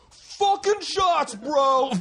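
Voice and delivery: high, drawn-out party yell; hammed-up rowdy fan yelling; rowdy bro shout. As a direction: holler it slowly like a drunk bro demanding shots. Voicing rowdy New York meetup fan.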